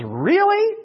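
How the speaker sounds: high, loud sceptical squeak; incredulous. Acted. Jesus answering Peter's boast, mock incredulous. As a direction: shoot way up in pitch, disbelieving.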